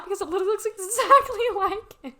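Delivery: amused, breaking into a laugh. Real, not acted. Laughing at the plushie.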